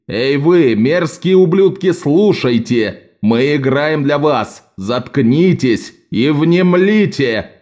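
rally speech. Shown as a demonstration. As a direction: seize the drunk crowd's attention. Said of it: "изменил голос и позу". (призыв к толпе, медленно и громко)

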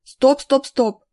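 loud, repeated stop command; acted order to stop; urgent halt. A narrator's reading. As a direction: halt everyone at once. Blocking the cave entrance.

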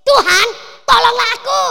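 loud high cry for help; desperate cry. Acted. Woman crying out to Jesus, high and loud. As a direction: shout up a desperate plea. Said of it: "Dan dia berkata kepada Yesus".

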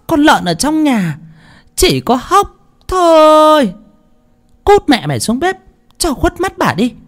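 shrieking mistress; furious berating. A narrator's reading. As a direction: rail at the maid in a high, harsh scold. Mistress berates the maid, driving her out.

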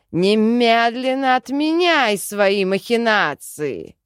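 loud, slow angry bellow; roaring. A narrator's reading. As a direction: bellow a furious demand, dragging the words. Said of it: "взревел Джин". (яростно реветь)

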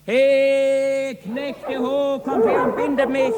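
loud high call for the servants. Acted. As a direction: shout for the servants to come. Cook calls servants to bind him.

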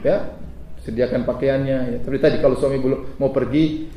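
normal talking voice

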